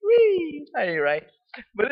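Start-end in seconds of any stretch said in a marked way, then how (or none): 0.0-0.7 high, loud "whee"; gleeful high squeal; playful squeal. Real, not acted. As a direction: let out a long, very high-pitched whee. Playful outburst during laughter about journal.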